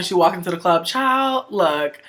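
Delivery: ordinary talk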